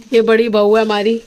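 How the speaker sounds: ordinary talk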